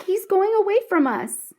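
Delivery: high, hurried alarm; boy warning that the cat is leaving, urgent. A narrator's reading. A rush of alarm. Brother urging after the cat.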